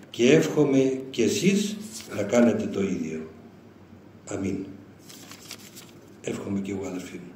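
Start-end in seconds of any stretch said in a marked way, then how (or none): 0.1-4.7 slow, grave blessing; solemn blessing. Retold or imitated. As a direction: give it slowly, like a blessing. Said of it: "λέει ο γέροντας".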